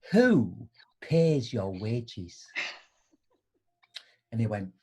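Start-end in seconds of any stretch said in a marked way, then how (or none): 0.1-2.4 pointed slow question. Retold or imitated.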